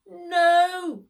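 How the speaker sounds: a loud, high shout of alarm; cry of no. A narrator's reading. Max cries out as the wind snatches the bagpipes, loud high shout. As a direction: yell it as the pipes fly away. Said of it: "cried Max".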